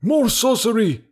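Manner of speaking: high, alarmed crowd shout; high-pitched shouted accusation; villagers shouting. A narrator's reading. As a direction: cry it out like an accusing mob. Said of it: "shouted the villagers".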